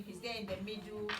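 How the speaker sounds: ordinary delivery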